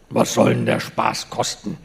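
words slurred together, half swallowed; lightly mumbled grumble. A narrator's reading. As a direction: mumble it low into the beard. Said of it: "nuschelte Schröer".

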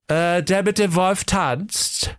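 high, drawn-out character voice; slow, hesitant dancer figure; dance-teacher character. A narrator's reading. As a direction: answer slowly in a high put-on voice. Suspect introduces himself in story.

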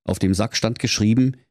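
plain reading voice

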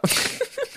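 airy high giggle; high breathy laugh. Real, not acted. Laughs after sign-off. (hohes hauchiges Kichern)

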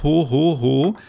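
quick, half-hearted ho ho ho; lightly mocked ho ho ho. Shown as a demonstration. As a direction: toss off a weak Santa laugh. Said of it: "Du betonst es nicht richtig".

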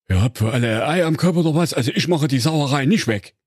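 schnelle, höhere, mürrische Dienerstimme; maulender Diener. Acted. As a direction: genervter Lakai, der nicht putzen will. Leibdiener meckert. (der Leibdiener weigert sich, die Sauerei wegzumachen)

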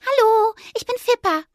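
high, bright mouse greeting; little mouse. A narrator's reading. Mouse introducing herself to the squirrel. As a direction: chirp a hello.

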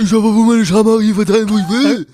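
loud, high, outraged rant; furious yell. Retold or imitated. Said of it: "der andere aus und sagt".

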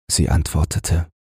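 plain reading voice

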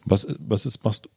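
normal talking voice

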